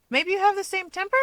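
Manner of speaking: curious probe. Acted. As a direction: toss the guess up lightly. Keigo probing, curious.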